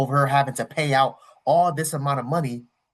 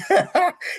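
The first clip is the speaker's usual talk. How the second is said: sharp high burst of laughter; cracking up at his own joke. Real, not acted. Teasing his homeboy about Japan, bursting into a high laugh.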